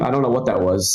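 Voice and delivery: normal talking voice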